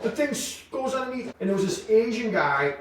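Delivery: ordinary delivery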